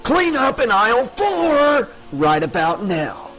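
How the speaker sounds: a smug ghoul savoring the joke; rasping monster. A narrator's reading. Scarred man mocking Tricia in aisle four, raspy and high. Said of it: "he rasped in that deep, oozing voice".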